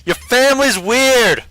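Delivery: high-pitched, drawn-out call; playful yell. Acted. Player using a free action to heckle an ally, playful yell. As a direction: yell it across the battlefield, slowly. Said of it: "free action to yell".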